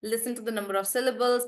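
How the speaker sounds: ordinary talk, nothing marked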